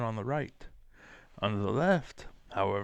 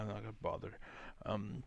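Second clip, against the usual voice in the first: ordinary talk, nothing marked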